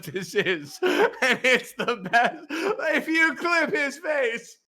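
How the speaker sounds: helpless high, slowed words through laughter; real laughing fit. Real, not acted. Quizmaster cracking up at a player's face, laughing hard. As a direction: barely get it out for laughing.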